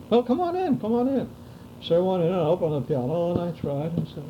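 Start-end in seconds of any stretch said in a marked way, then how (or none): 0.1-1.3 higher, lightly voiced eager host; a hint of a nervous homeowner waving him in; welcoming German homeowner. Homeowner to the pianist at his door, higher eager welcome. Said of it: "German homeowner invites him in".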